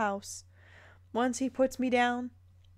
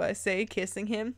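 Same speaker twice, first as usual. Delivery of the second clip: normal talking voice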